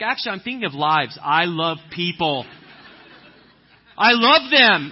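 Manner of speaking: high, loud, laughing mock retort; mock reply. Other driver retorting to the impatient pastor, mock and high. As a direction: play the other driver's smug answer. Voicing the pedestrians' defender.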